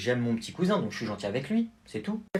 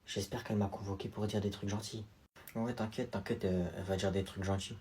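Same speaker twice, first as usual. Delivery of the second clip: soft, lower private thoughts; inner voice; 6 semitones lower, 11 dB quieter than usual. Acted. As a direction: murmur your hopes to yourself. Voix intérieure, chuchotée. (pensée intérieure à voix basse)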